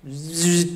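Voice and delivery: a short mimicked cicada buzz; imitated buzzing. The cicada replying on his shoulder, a short buzz. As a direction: answer back with a bug's buzz.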